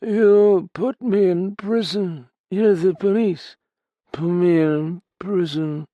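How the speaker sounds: put-on high, slow, slurred drunk; drunken mumble. Drunk begging the policeman to jail him, slurred. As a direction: beg to be locked up. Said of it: "He mumbled".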